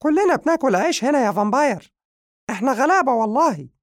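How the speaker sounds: very high, loud, fast squeaky voice pleading; sincere cat. Acted. The cat answering the grateful vampire, high and sincere. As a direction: squeak it out earnestly like a poor little cat. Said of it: "رد عليه القط بصوت صادق".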